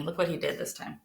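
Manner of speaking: ordinary delivery, nothing marked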